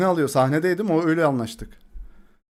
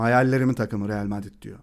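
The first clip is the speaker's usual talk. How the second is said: usual speaking voice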